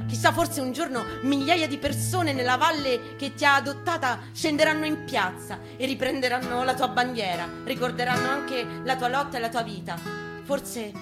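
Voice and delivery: usual speaking voice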